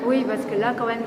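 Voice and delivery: ordinary talk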